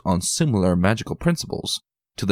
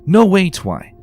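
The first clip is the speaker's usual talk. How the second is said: high, loud, fast alarmed dragon; Spike protesting loudly; 12 semitones higher, 7 dB louder than usual. A narrator's reading. Spike blocking Twilight at the door, loud and alarmed. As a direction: cut her off in a panic.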